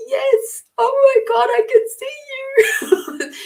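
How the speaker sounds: very high, crying and laughing at once; teary joy. Retold or imitated. Tearfully telling the client she can see the fairies. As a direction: overcome with happy tears. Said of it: "I got all teary".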